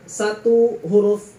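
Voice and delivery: Satu huruf dipanjangkan, sangat lambat; slow drawn out. Shown as a demonstration. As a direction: ucapkan "Satu huruf" pelan dan panjang. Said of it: "kita praktek". (mengeja pelan seperti guru memberi contoh)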